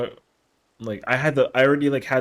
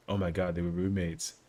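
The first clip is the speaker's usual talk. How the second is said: "Oh my God, they were roommates" much lower and softer; low murmured realization; 6 semitones lower, 9 dB quieter than usual. Shown as a demonstration. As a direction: mutter it to yourself, deflated. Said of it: "yo, they were roommates, actually".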